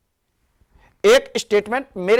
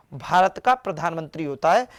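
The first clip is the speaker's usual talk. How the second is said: ordinary talk, nothing marked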